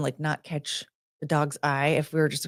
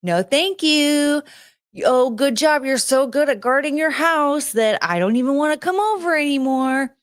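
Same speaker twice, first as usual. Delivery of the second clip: sarcastic praise; 8 semitones higher than usual. Retold or imitated. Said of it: "be like".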